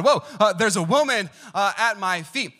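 startled fright. Retold or imitated. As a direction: jolt back in alarm. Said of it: "Boaz was terrified".

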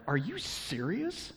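high, drawn-out disbelief; slow, incredulous shock without raising the volume. Acted. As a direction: question it in stunned indignation. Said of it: "It's like one part shocked".

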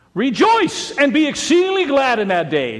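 high, loud proclaiming of Jesus' words; preached shout. Acted. Pastor quoting Jesus to the congregation, loud high proclamation. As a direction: ring it out to the back row. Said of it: "I believe he said something like".

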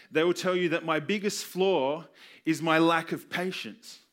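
ordinary delivery

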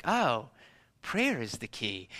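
put-on low, soft, slow little kid's awe; awed child realizing. Child answering his parents, in hushed slow awe. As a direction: murmur it like a kid who just cracked the secret. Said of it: "And I was like".

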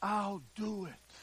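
very soft, slow promise; hushed and unhurried, as God replying; slow, quiet reassurance; God's quiet answer. Acted. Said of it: "God said".

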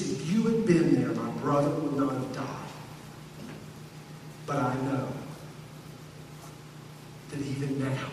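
low, soft, hushed belief; quiet faith. Acted. Grieving Martha quietly telling Jesus she still believes. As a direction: let it sink to a tender hush.

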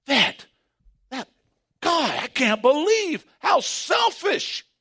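slow, high shout of disbelief; mock outrage. Preacher shouting mock outrage at Elijah's selfishness. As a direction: cry out in staged outrage at the prophet.

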